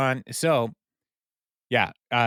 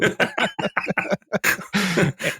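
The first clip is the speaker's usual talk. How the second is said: high laugh; 9 semitones higher than usual. Real, not acted. Laughing at own retelling.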